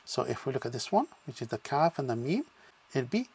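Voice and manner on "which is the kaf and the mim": usual speaking voice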